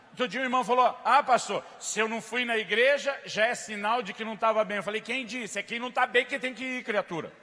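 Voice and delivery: loud, high, forceful preaching; fiery preaching. Acted. Pastor to congregation, loud fiery preaching.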